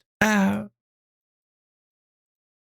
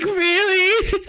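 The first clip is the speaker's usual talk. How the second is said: mock amazement; 9 semitones higher than usual. Mocking her own reaction to a shirt on sale, high gushing. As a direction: overplay the delight at a shirt sale. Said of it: "I'm like".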